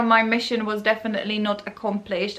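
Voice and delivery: ordinary delivery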